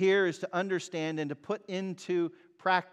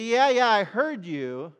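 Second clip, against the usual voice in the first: put-on dismissive child; 6 semitones higher, 7 dB louder than usual. Child brushing off a parent.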